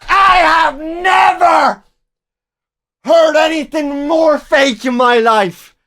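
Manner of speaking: high, loud and slow disbelief; loud, slow, stretched-out disbelief; incredulous shout. Real, not acted. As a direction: drag out the scorn at full volume. Mocking the fake voicemail.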